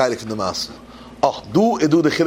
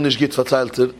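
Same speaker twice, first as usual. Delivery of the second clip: ordinary talk, nothing marked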